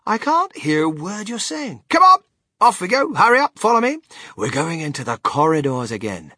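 high, loud, hurrying showman; Willy Wonka, impatient. Acted. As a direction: urge the group along briskly.

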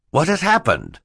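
high, startled asking; alarmed question. Acted. Mister Poe asks about the death, alarmed. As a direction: ask what went wrong, shocked.

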